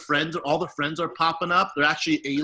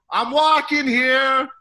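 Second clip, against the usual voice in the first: very high, loud, drawn-out yell; full-out cartoon uncle impression; catchphrase holler; 9 semitones higher, 8 dB louder, much slower than usual. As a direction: belt the catchphrase across a schoolyard. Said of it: "And I go".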